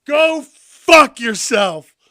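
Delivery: slow, loud, high yell; loud mock yell. Replaying Cody's uncensored line, loud and slow. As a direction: drag it out, relishing every word. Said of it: "We heard it in all its four-letter glory".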